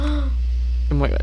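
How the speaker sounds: high, slow "oh my God"; shocked gasp. Retold or imitated. Shocked exclamation in story.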